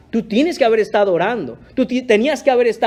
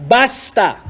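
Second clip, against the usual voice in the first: firm rebuke; 3 semitones higher than usual. Retold or imitated. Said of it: "la amonestación clara y dura".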